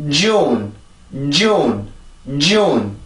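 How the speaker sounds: JUNE with a strong J leading into a falling vowel; English pronunciation. Shown as a demonstration. Teacher models the whole word, English pronunciation. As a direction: push off the J to stress JUNE. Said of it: "힘을 받아야 돼요".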